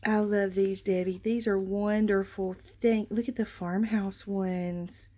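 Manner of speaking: ordinary talk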